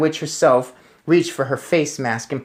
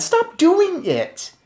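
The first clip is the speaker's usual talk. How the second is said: put-on very high, slow pleading boy; upset child plea; much slower than usual. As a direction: beg him to stop.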